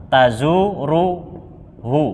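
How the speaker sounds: "tazuruhu" drawn out slowly, each syllable clear. Shown as a demonstration. Teacher spelling "tazuruhu" slowly for the class. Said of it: "lafadznya begini, biar lebih kelihatan".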